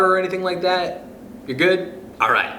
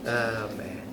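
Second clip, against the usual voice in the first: ordinary delivery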